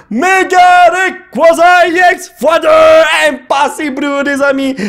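slow, high, shouted card name; triumphant excitement. Real, not acted. Collector showing friends his two Rayquaza, shouting triumphantly. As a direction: proclaim it big and high in amazement.

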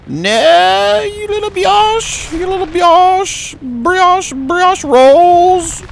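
very high, slow squeaky taunt; stretched-out high-pitched mockery. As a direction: squeal it at him like a cartoon mouse. Squeaky mock voice at enemy.

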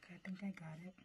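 soft unvoiced whisper. Real, not acted. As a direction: breathe the words to the camera. Whispering while filming the food.

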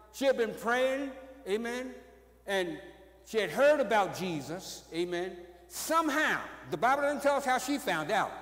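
ordinary talk

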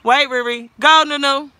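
loud, high parental countdown. Real, not acted. Parent counting down for children's tricks.

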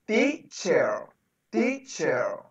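"Teacher" split into tee... cher; syllable-by-syllable model. Shown as a demonstration. Teacher correcting a pupil's greeting, syllable-by-syllable model. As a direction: sound out "Teacher" in two separated syllables.

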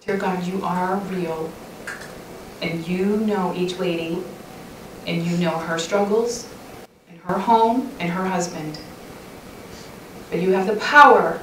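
soft, unhurried prayer voice; closing prayer. Real, not acted. Speaker praying aloud over the ladies, soft and earnest. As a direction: pray it gently over the listeners.

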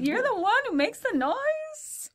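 put-on very high, slow Eastern European old lady; high-pitched, hammy Eastern European accent; vague Eastern European accent. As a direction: imitate her astonishment in a drawn-out Eastern European accent. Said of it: "still don't know what kind of accent".

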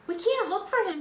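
high, very loud protest; alarmed shout. A narrator's reading. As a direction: shout over the disaster. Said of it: "shouted Jack".

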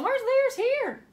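high, loud, mock bafflement; puzzled question. Teacher pretending not to find the ears, high and loud. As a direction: ham up the confusion.

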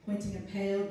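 usual speaking voice

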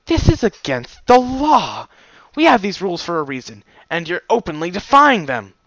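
loud, high, outraged cry; guard mare shouting outraged at the stallion; outraged protest. Acted. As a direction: protest against breaking the rules. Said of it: "Ginger looked outraged".

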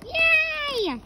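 high, loud cheer; cheering yay. Acted. Kid cheering about the road trip, excited. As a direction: squeal with delight.

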